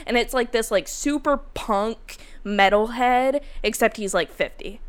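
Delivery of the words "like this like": plain reading voice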